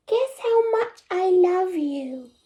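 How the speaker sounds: high squeaky little hare. Acted. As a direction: play the eager little bunny. Child hare voiced high.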